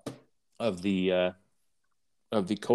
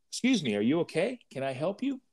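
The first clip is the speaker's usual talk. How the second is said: higher, softer, quick fox voice; mock-polite fox, lightly voiced; sly fox; 6 semitones higher, 6 dB quieter, faster than usual. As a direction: slip in like a too-friendly fox. Fox offers help in parable.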